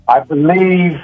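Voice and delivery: ordinary delivery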